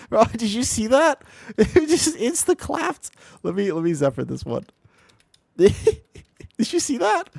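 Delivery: high, giggling disbelief; laughing disbelief. Real, not acted. Streamer laughs in disbelief at a teammate's instant death. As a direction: marvel at it through laughter.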